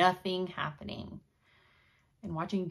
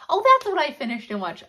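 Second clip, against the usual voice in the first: much higher and louder, bursting out; sudden recall; 9 semitones higher, 12 dB louder than usual. Real, not acted. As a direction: blurt it as the memory hits. Suddenly remembers finished show.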